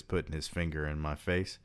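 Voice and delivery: ordinary delivery, nothing marked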